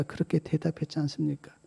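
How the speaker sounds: ordinary talk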